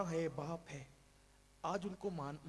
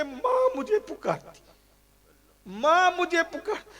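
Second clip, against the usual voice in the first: anguished cry; 12 semitones higher, 14 dB louder than usual. Acted. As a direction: cry out for a mother. Crying out longing for mother.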